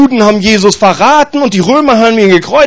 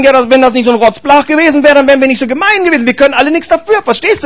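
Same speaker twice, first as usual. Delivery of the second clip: mock defensive parents; 7 semitones higher, faster than usual. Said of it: "Oder die Eltern sagen".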